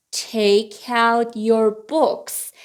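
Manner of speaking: slow, drawn-out learner's model; slow model reading. Shown as a demonstration. Teacher repeats the books sentence for learners, slowly. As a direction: give each word room, slowly.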